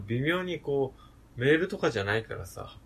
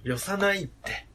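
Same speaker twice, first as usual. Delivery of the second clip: higher playful fake Kansai; mock Kansai reply; 6 semitones higher than usual. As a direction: toss it back in put-on Kansai. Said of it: "関西弁じゃん".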